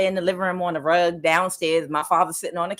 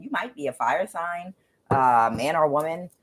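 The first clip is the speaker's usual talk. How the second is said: usual speaking voice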